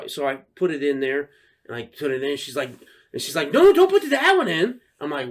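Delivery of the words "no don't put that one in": put-on high, loud, urgent kid voice; protesting child. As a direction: imitate a child stopping a parent. Retold child objecting to parent.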